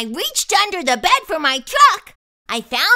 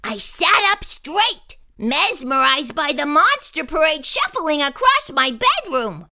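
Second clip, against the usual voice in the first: ordinary delivery, nothing marked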